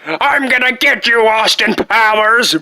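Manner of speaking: villain vowing revenge on Austin; vengeful shout. Acted. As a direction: shake a fist at the enemy.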